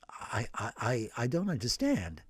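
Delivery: stunned priest. Acted. Priest repeating the huge sum, halting and hushed. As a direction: stammer it out in shock.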